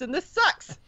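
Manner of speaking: laughing. Real, not acted. Joking complaint about High Lord titles.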